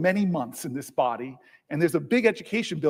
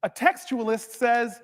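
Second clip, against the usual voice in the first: higher, slow, building indignation; fervent oratory; 6 semitones higher than usual. Acted. Senator denouncing the president's Alien Enemies Act claims, rising indignation. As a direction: preach it slowly and insistently.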